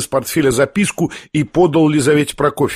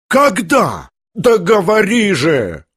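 higher, drawn-out insistent cry; impatient demand; 5 semitones higher, much slower than usual. A narrator's reading. Lizaveta demands that the prince tell her, impatient. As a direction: demand an answer at once, voice raised in pitch.

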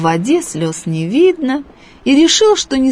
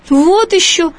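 higher, louder hurt cry; offended shout; 9 semitones higher, 4 dB louder than usual. A narrator's reading. The boy to the fish, an offended cry, higher. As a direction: cry out, stung. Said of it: "обиженно крикнул мальчик".

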